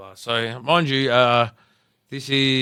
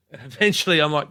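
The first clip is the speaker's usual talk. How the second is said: ordinary talk, nothing marked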